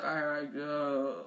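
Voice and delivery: soft, low thinking aloud; retold doubts, fading very soft and low; reluctant inner voice. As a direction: hesitate quietly, as if to oneself. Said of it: "in my head, I was like".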